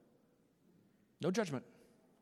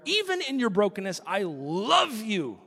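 vow; 6 semitones higher, 6 dB louder than usual. Acted. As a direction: lean into the promise. Voicing wedding vow.

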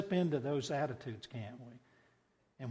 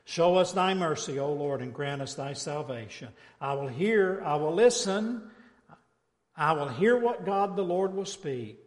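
loud, raised scripture reading; preach; 2 semitones higher, 6 dB louder than usual. Acted. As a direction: declaim the psalm.

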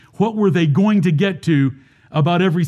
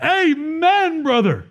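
high, drawn-out mock amen call; acted congregational amen; 9 semitones higher, much slower than usual. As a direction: imitate an approving crowd calling out, pitch swooping up. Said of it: "They said three amens".

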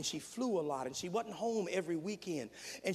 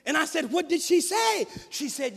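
loud, rising preacher's cadence; full-force preaching; 9 semitones higher, 11 dB louder than usual. Real, not acted. Preacher pressing the point on the congregation, full force. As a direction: drive it home from the pulpit.

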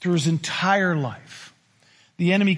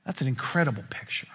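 whisper; 5 semitones lower, 7 dB quieter, faster than usual. Real, not acted. Hushed aside in sermon.

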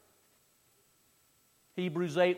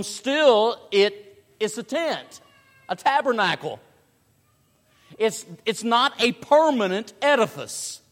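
raised, louder sermon voice; forceful preaching; 7 semitones higher, 8 dB louder than usual. Real, not acted. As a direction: drive the point home from the pulpit.

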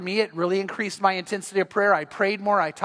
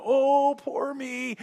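self-pitying whine; 5 semitones higher, much slower than usual. Pretend. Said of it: "walking around going".

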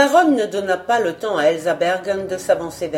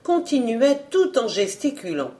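ordinary delivery, nothing marked

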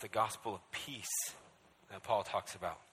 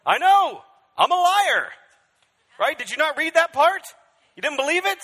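much higher, louder taunting enemy voice; mocking enemy; 18 dB louder than usual. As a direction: gloat at the fool who trusted him. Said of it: "he'll be like".

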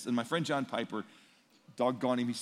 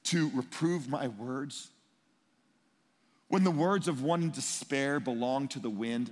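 slow reading of scripture; slower than usual. Retold or imitated. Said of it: "He says".

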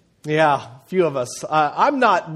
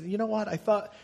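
imitating sad son; 7 dB quieter than usual. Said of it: "He said".

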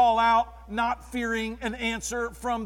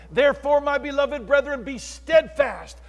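louder, emphatic sermon delivery; full-force preaching; 2 semitones higher, 6 dB louder than usual. Acted. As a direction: preach it out strong.

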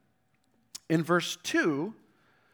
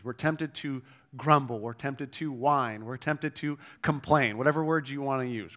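usual speaking voice

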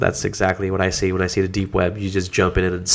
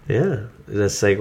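ordinary talk, nothing marked

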